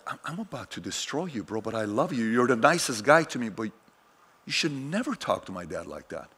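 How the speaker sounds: low-volume, held-back threat; restrained warning. Retold or imitated. Son silently warning the relative who insulted his dad, restrained and quiet. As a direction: warn him quietly, keeping it contained.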